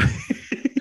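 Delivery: very high squealing laugh. Real, not acted. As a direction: burst into a shrill giggle. Laughing at own joke.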